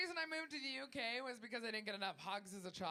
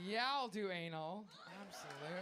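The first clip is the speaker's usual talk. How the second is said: hammed-up low come-on; mock seductive consent; 6 semitones lower than usual. Acted eager consent to unappealing date.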